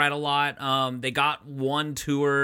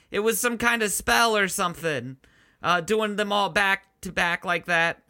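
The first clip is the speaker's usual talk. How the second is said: higher, hesitant, stumbling testimony; awkward, nervous witness; 6 semitones higher than usual. Acted. Dumped prom date testifying in court, awkward nervous voice. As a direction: stammer through it with lots of uhs.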